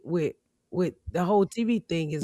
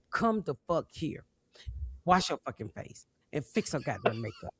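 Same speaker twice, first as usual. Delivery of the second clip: low stern order; 3 semitones lower, 8 dB quieter than usual. Retold or imitated. Calm stern order to makeup.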